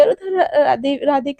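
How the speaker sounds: plain reading voice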